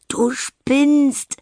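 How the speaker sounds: breathless gasp, high and loud. Acted. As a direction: gasp it out between laughs. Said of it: "japst Anna".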